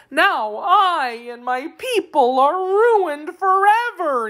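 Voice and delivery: high, slow theatrical wail; sobbing. A narrator's reading. Captured Wheeler despairing over his ruin, mock sobbing. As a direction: lament it in a high, drawn-out voice. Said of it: "he sobbed".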